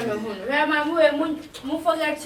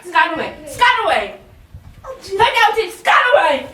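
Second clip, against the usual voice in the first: raised voice, higher and louder; shouted orders; 7 semitones higher, 7 dB louder, slower than usual. Acted. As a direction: call out each order forcefully. Acted repeated shouted orders.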